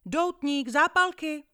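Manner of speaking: high, loud hawker's cry; shouted offer. Acted. Boy hawking cigars to a couple, high and loud. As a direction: yell the wares into the compartment. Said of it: "Zakřičel jsem".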